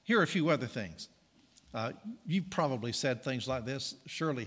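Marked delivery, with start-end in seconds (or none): none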